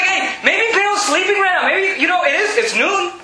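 high, playful taunting; mocking. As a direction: tease them with a mock explanation. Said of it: "Elijah's just having fun with these guys".

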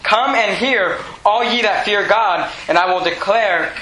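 normal talking voice

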